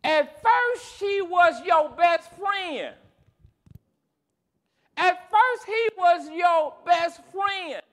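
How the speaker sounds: high, forceful pulpit refrain with long pauses; impassioned preaching. Real, not acted. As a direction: stretch each phrase out to the congregation.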